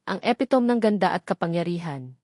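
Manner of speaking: ordinary delivery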